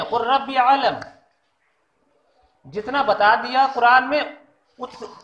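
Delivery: usual speaking voice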